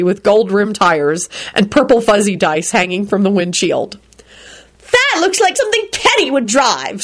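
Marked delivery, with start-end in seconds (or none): 4.9-7.0 high screeched complaint with little volume; scream. A narrator's reading. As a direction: mock-scream it, pitch way up. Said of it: "screamed Rosie".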